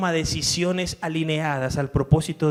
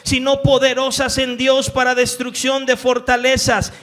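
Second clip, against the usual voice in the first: raised, loud scripture reading with driving force; fervent preaching; 9 semitones higher, 9 dB louder than usual. Acted. Pastor reading Paul's letters aloud to the church, fervently. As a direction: proclaim each verse to the back row.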